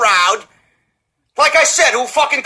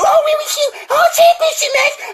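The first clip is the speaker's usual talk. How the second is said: much higher, shouted mock whine; mocking whiny fan. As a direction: sneer at the fans in a high whiny voice. Mocking fans' complaints.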